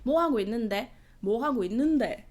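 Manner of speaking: put-on wavy, sing-song Busan accent; mock the Busan lilt with big swoops; exaggerated Busan dialect. Mocking the Busan guy's accent for viewers, exaggerated Busan dialect. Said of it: "In the Busan".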